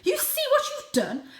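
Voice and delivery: high, loud reproach; reproachful mother. Acted. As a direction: blame the old cat. Mother blames the elder.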